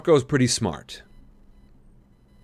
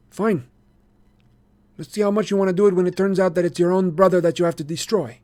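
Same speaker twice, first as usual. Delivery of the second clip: lightly voiced sly boy; 9 semitones higher, 4 dB louder than usual. A narrator's reading. Marco voiced in story.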